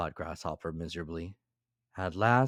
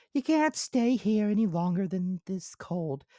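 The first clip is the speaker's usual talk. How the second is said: high, louder busy little ant; high squeaky ant character; 4 dB louder than usual. Acted. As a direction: voice the ant as small and sensible.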